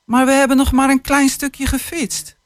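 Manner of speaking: hint of a child whining; child's protest. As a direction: plead like a disappointed five-year-old. Young Mick protests to father.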